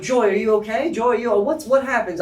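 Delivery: loud, high concerned calls; worried guests. Acted. Party guests calling out to Joy after her fall, loud and high. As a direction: crowd fussing over a fallen lady. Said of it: "people around who are like".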